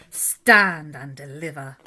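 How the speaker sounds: low, loud robber's command; thundering demand. A narrator's reading. Rat holds up the squirrel, loud thundering demand. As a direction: boom it out like a highwayman. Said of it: "who thundered".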